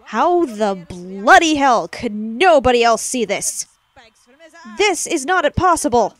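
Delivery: loud, incredulous rant; exasperated outburst. Real, not acted. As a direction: rant in disbelief at a glaring plot hole. Ranting at an overlooked plot hole.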